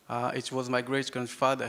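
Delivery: ordinary talk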